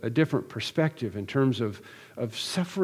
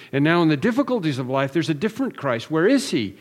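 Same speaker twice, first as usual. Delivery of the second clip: higher, louder, insistent 'no, never'; fervent preaching; 3 semitones higher, 4 dB louder than usual. Acted. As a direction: hammer the promise home to the congregation. Preaching at full force.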